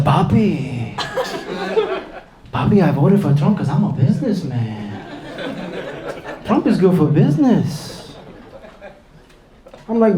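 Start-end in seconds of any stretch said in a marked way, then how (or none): 0.0-8.1 lowered, drawn-out smug businessman; mock-smug buddy; buddy's voice. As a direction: play the proud hustler friend, slower. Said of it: "He's like".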